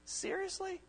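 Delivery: high, breathy-soft disbelieving "Seriously?"; incredulous. Retold or imitated. Youth speaker imitating someone stunned by a rude text, high and disbelieving.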